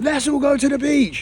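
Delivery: high, excited retold call; eager suggestion. Husband rallying family to leave the resort, high and excited. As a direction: pipe up keenly, acting out your own bright idea.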